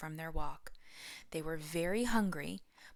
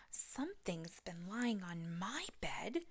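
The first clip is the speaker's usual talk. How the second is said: scared whisper; 7 dB quieter, slower than usual. Acted. Cub whispers fearfully.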